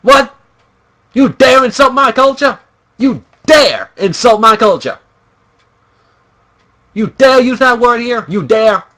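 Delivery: high, loud, indignant outburst; hammed-up indignant challenge; mock outrage. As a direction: act mortally insulted, punching each "dare". Acts outraged member of offended culture.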